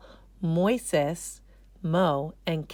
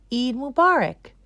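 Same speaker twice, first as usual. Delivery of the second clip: festive greeting; 7 semitones higher, 6 dB louder than usual. A narrator's reading. Greeting on the sign.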